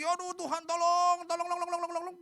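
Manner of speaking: high wail breaking into frantic repeated pleas; hammed-up, stressed-out begging; crying out. Israelites begging God for help, acted frantic cries. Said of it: "Mereka teriak lagi".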